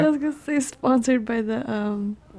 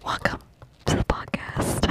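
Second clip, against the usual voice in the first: soft whispered welcome; playful ASMR host; 8 dB quieter than usual. Shown as a demonstration. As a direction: breathe the greeting in a hush, with pauses. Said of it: "is snapping A S M R".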